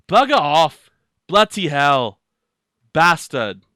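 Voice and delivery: loud Aussie curses, one by one; Australian accent. Shown as a demonstration. Australian accent, demoing British swears to a friend. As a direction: bark each swear in a broad Aussie accent. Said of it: "you have to do it with the accent".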